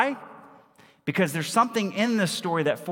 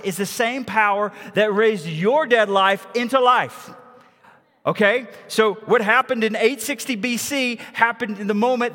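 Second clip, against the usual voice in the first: loud, higher, driving sermon climax; preaching at full force; 6 semitones higher, 4 dB louder than usual. Acted. Pastor to congregation, rousing full-force preaching. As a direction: proclaim it to the whole church.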